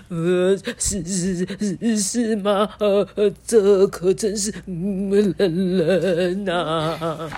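trembling stutter, long quavering last word; shivering voice. A narrator's reading. Monkey replies while freezing, voice trembling. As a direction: chatter and shudder through every syllable. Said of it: "颤抖的声音还没停".

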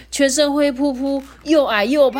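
high, loud rising dismay; dismayed realisation. Acted. Lulu sees his own reflection, increasingly dismayed. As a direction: build up in horror at each flaw.

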